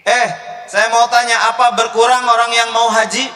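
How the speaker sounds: calling out. Real, not acted. Preacher calls to crowd.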